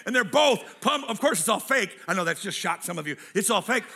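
normal talking voice

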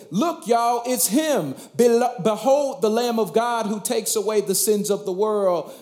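high, eager announcing of the Lamb; excited. Acted. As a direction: point him out with sudden joy. John the Baptizer voiced excitedly.

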